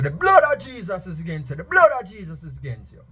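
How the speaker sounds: loud, high and forceful rebuke; forceful declaration. Retold or imitated. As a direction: cast it out with force. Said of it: "them say".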